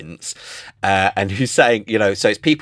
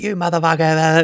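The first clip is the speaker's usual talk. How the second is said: mock outrage; 7 semitones higher than usual. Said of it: "him just being like".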